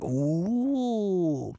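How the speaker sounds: odd cooing alien ooh; mock little alien coo; alien toy ooh. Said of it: "they sounded exactly like".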